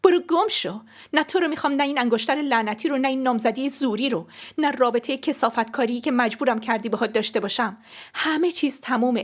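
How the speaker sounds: high, loud furious rejection; angry outburst. Acted. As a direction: throw each refusal at him. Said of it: "با عصبانیت انگشتری که بهم داده بود".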